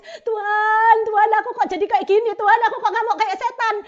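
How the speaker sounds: loud, pleading lament to God; a mother's loud remorseful prayer; anguished cry to God. Retold or imitated. Retold crying prayer to God.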